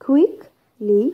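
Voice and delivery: "quickly" split, QUICK stressed, then -ly; stress on first syllable. Shown as a demonstration. Teacher giving adverb examples, first-syllable stress on "quickly". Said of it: "stress on the first syllable".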